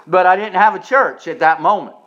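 ordinary talk, nothing marked

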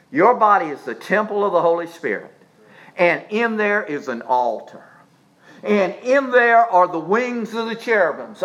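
usual speaking voice

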